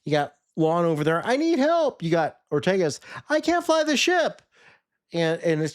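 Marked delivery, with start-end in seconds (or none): 1.2-2.0 much higher, pleading puppet voice; mock puppet plea, pitched way up; whiny puppet Lon. 3.3-4.4 much higher, complaining puppet voice; mock puppet whine, pitched way up; whiny puppet pilot. Ortegas voiced as helpless puppet.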